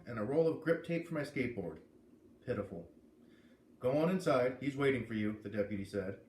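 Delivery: lively first-person read-aloud; story narration. A narrator's reading. A reader opening chapter one for listeners, in lively narration. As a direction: read the chapter to your audience, brightly.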